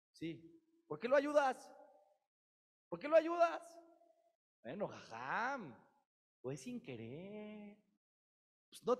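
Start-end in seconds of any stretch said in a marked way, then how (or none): 0.9-3.8 loud, high, outraged question; indignant demand. Acted. Preacher challenging the listener for helping, loud outraged demand. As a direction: demand it in disbelief.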